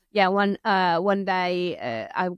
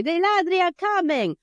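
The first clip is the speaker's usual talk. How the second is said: put-on high child voice with ladri said the Italian way; Italian accent; 11 semitones higher, 5 dB louder than usual. Child mixing an Italian word into English, Italian-accented. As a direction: ladri rolled Italian-style inside English. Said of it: "they would use an Italian la-, uh, accent".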